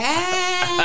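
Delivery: put-on high, sing-song drunk hey; sloppy drunk greeting then laugh. Imitating her drunk snap.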